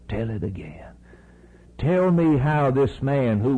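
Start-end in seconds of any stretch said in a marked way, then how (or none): 0.1-0.8 a hushed, pleading repeat; whispered echo. Acted. Blind man begging his friend to repeat, whispered. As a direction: breathe it in a whisper. Echoed plea dropped to a whisper.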